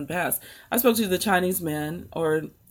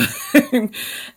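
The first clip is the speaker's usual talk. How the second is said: high laugh; 6 semitones higher, 4 dB louder than usual. Real, not acted. Laughing at the retold encounter.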